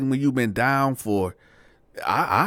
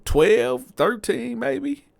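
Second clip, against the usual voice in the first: unsure; 12 semitones higher, much slower than usual. Retold or imitated. Unsure guessing age, pitch jump.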